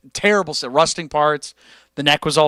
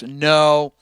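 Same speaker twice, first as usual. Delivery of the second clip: ordinary talk, nothing marked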